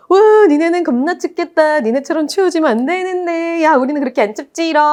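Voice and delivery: high singsong taunt; mock teasing. Temperate climate making fun of cold climate, mock teasing. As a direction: tease them like a gloating child. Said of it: "온대가 또 냉대도 놀려".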